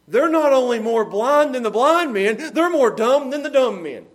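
loud, driving pulpit delivery; full-force preaching. Acted. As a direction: preach it hard to the congregation.

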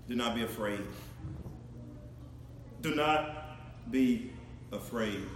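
gentle reassurance. Acted. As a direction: soothe him slowly, almost hushed. God reassuring Jacob softly.

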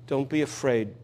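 soft, tender comfort; quiet, reassuring words of Jesus; gentle reassurance. Acted. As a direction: speak it like calming a frightened father. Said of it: "And Jesus says".

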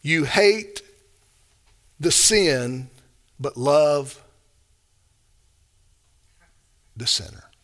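halting, tentative misreading with long pauses; mock modern reading. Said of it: "How would modern Christians read that verse? Let me try it".

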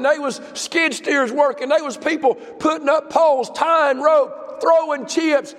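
full-throated revival preaching; preaching at full force. Acted. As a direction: preach it up to the congregation. Pastor preaching about revival work.

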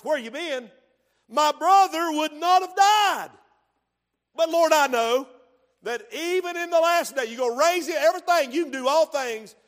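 loud, high, near-shouted grieving complaint; confrontational Martha. Retold or imitated. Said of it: "She's a little confrontational".

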